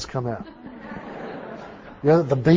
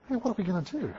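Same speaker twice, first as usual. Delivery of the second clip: hushed, higher-pitched question; discreet aside; 5 semitones higher, 12 dB quieter, faster than usual. Retold or imitated. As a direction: ask a colleague under your breath. Sidles up to ask colleague discreetly.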